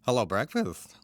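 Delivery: imitating Daffy's comedian-like intonation; Daffy impression. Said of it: "say it with a different inflection, different intonation".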